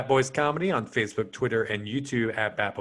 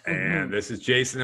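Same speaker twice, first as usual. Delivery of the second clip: ordinary delivery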